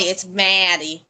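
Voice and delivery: slow Maddie with an a as in cat, said mad-ee; Maddie with an accent. Shown as a demonstration. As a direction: draw out Maddie slowly, mad-ee with a flat a. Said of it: "You gotta say it with accent".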